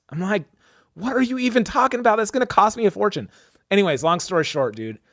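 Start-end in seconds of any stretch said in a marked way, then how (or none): 0.9-3.3 exasperated outrage. Retold or imitated. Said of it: "getting more mad".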